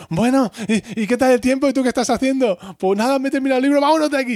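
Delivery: high, rushed chatter; awkward small talk. Retold or imitated. The two women, embarrassed, chattering quickly to drown out the neighbours. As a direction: babble fast to cover the noise.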